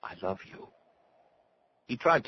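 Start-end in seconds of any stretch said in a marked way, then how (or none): 0.0-0.7 very soft, intimate confession; hushed declaration of love; tender whisper. A narrator's reading. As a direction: whisper it close to the lips. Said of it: "Doreen whispered".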